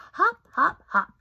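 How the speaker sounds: high, loud playful hops; bouncy hops. Acted. Reader bouncing out the bunny's hops for kids. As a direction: bounce each hop.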